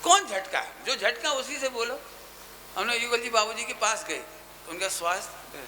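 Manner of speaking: usual speaking voice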